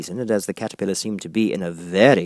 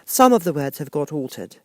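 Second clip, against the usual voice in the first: timid Alice; 7 semitones higher, 4 dB louder than usual. A narrator's reading. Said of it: "said Alice timidly".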